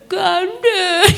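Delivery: high, loud mock-tearful whine; child's reply. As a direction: imitate a sobbing little one giving in. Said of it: "Mau digandeng atau mau jalan sendiri".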